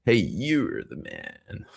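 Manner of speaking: slow, drawn-out "you're the man"; slow drawn-out hype. Retold or imitated. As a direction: stretch it out like hyping a buddy. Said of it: "help him out and go".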